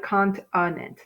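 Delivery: "Continent" sounded out as cont-UH-nent; "Continent" in syllables with a schwa; schwa demonstration. Shown as a demonstration. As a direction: make the middle of "Continent" a clear uh. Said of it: "naturally it's continent".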